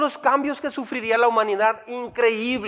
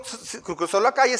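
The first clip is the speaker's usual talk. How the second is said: ordinary delivery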